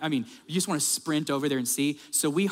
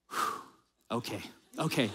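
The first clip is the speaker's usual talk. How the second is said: soft sigh of giving in; 8 semitones lower, 8 dB quieter than usual. Retold or imitated. Gate agent moved by crying child.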